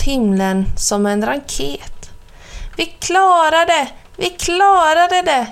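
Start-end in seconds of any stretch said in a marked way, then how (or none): 2.8-5.5 high, loud cheering cry; yelling with joy at success; excited shout. A narrator's reading. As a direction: shout in triumph, pitched up. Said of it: "ropade Christopher Robin".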